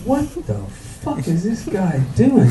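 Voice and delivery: a high startled squeak on "What", then it settles; baffled at the stranger's odd moves. Retold or imitated. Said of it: "I'm like".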